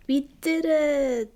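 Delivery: a small triumphant whoop after the push; cheering. Acted. Moana cheering with friends after the tree falls, excited. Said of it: "cheered Moana".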